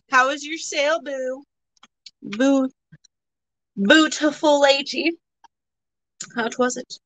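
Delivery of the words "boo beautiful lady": ordinary delivery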